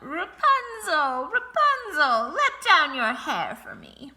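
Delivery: high, loud, slow witch's call. A narrator's reading. Sorceress calling up to Rapunzel in the tower, loud and slow. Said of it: "she would stand below and call out".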